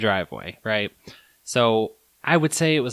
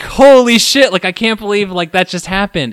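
higher, louder stunned exclamation, then settling; shocked disbelief; 7 semitones higher, 8 dB louder than usual. Retold or imitated. Storyteller reliving his shock to the driver, stunned. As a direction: let the shock spill out.

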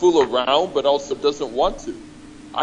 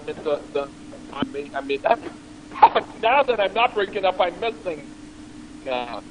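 distant, off-mic voice; far from mic; 4 semitones higher, 4 dB quieter than usual. Real, not acted. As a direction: sound like you're across the room. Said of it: "you sound like you're far away now".

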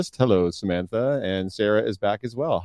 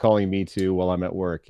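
ordinary talk

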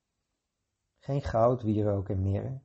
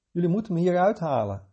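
higher, louder urgent plea; 8 semitones higher, 7 dB louder, faster than usual. A narrator's reading. Trapped child begs the couple, higher and louder. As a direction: press them to free her.

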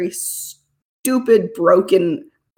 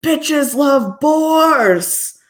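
high, slow, drawn-out yell; yelling orc; 8 semitones higher, 4 dB louder, much slower than usual. Retold or imitated. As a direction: bellow it like a happy orc. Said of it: "just yelling".

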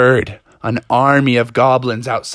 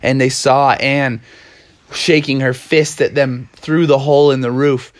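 ordinary delivery

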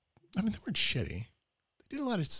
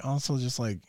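normal talking voice

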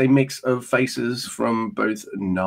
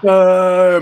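a loud, hammed-up crowd boo; mock heckle, loud; louder mock boo; 6 semitones higher, 7 dB louder than usual. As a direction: jeer it out like a rowdy fan.

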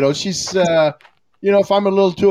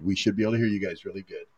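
lower, softer, faster repeat; quiet; 8 semitones lower, 8 dB quieter, faster than usual. Real, not acted. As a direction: mutter it again under your breath. Repeating the point, muttered.

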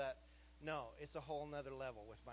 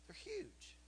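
high huge; 6 dB quieter than usual. Real, not acted. Repeating about kids' spirits.

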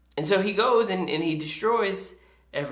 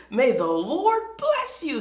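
bright, higher, slowed-down hello; cheerful greeting; 11 semitones higher, 3 dB louder than usual. Acted. Saul greeting the prophet Samuel, mock cheerful and higher. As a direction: greet him with over-sweet cheer. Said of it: "Saul greeted him cheerfully".

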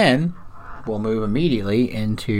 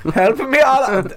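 high imitation of an Indian-accented plea; mimicked Indian accent; 13 semitones higher than usual. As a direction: copy the high-pitched accented cry for help. Mimics the accented movie quote.

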